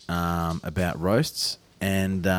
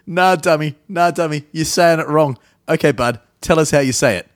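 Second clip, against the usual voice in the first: loud, mocking Baltimore accent; other Baltimore mate; 7 semitones higher, 8 dB louder than usual. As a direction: scoff "nah, dummy" in a thick Baltimore accent. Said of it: "his other mate's gonna have a go".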